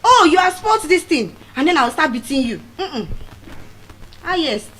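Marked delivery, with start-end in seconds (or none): 0.0-1.4 louder, higher mock scolding; hammed-up parent telling off child; mock angry parent. Parent scolding a child over broken things, mock angry. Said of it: "I will not (say)".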